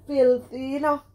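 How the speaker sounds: loud, high, shocked "filthy"; scandalized outcry. Real, not acted. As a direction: cry it out in disgust at her filthy mouth. Scolding Curly's mouth.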